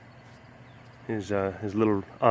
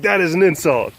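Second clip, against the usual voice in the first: voicing the animal; 7 semitones higher, 8 dB louder than usual. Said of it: "She's just like".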